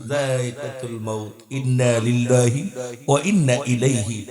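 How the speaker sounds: low, measured Arabic recitation; Quranic recitation. Real, not acted. Preacher recites the verse on death to congregation, low solemn recitation. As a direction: recite solemnly with drawn-out vowels.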